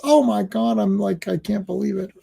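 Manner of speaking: ordinary talk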